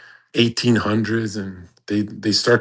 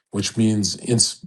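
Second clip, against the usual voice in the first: ordinary delivery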